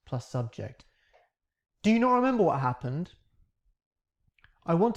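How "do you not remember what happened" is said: not lifted with a rising tune; stress on not. Shown as a demonstration. Teacher demoing the closed question, stressing not. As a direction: lean on "not" and lift the end. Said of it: "here's our auxiliary verb".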